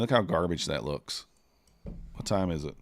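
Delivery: ordinary delivery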